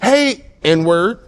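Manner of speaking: mild imitated yell, pitched high; retold yell. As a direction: call it out high, as if across a road. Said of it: "this dude yelled at me".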